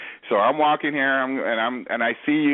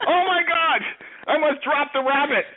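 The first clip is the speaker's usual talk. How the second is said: mock panic; 12 semitones higher than usual. Gangster panics over disease.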